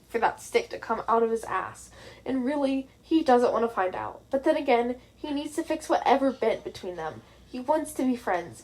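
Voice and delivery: ordinary delivery, nothing marked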